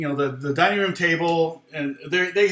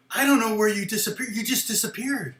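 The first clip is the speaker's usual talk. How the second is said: imitated amazement; 7 semitones higher than usual. Retells the guy's amazed exclamation.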